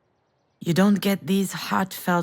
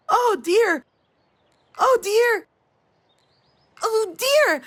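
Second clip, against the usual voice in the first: high, louder mock child's scream of Oh, dear; imitating a screaming toddler; 13 semitones higher, 5 dB louder, slower than usual. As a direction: mimic the little girl wailing Oh, dear.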